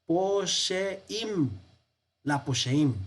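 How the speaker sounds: posheim read out carefully, stress on -sheim; reading Hebrew aloud. Shown as a demonstration. Teacher reading the manuscript word aloud, posheim spelled out in sound. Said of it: "aquí dice".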